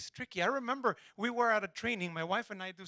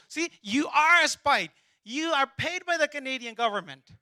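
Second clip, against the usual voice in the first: loud triumphant accusation, slowing on "See, you are"; accusing shout; 6 semitones higher, 11 dB louder than usual. Retold or imitated. Interrogator pinning the captive friend as a spy, shouting. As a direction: bark it like you caught him out.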